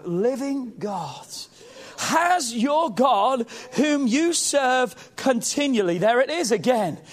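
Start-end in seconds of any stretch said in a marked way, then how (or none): 0.0-6.0 slow, drawn-out pleading question; lamenting plea. Acted. The king pleads toward the den, slow and lamenting. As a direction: beg for an answer you fear. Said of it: "a voice of anguish".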